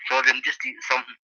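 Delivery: usual speaking voice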